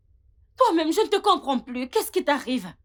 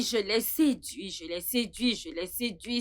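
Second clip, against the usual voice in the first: softer and lower with each repeat; fading seductive taunt; 6 semitones lower, 7 dB quieter than usual. Acted. Tamara boasting softly that she seduced the husband. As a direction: let it sink to a murmur, relishing it.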